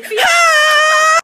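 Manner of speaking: high, loud squealed "Hi"; mock greeting. Girls greeting the camera in a roast video, loud squeaky "Hi". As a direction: squeal "Hi" like a fake-sweet popular girl.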